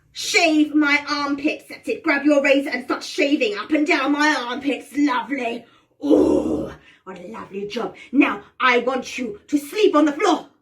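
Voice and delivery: bossy witch. Pretend. Witch orders players to groom her, bossy. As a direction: lord it over your servants. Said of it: "I'm going to order you around".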